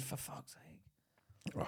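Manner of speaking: breathy exasperated whisper; whispered curse. Real, not acted. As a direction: mutter it under your breath. Exasperated aside under breath.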